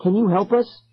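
high plea with rising end; begging. A narrator's reading. Lost kid to chanting circle, begging. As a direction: beg for help, voice lifted. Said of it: "I begged".

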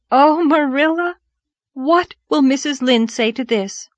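high, loud wondering cry; excited exclamation. Acted. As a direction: exclaim at the coming scandal. Anne exclaims over the news.

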